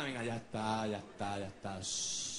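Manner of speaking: calm the frightened panda gently; soothing. Acted. (consolando al oso en voz baja)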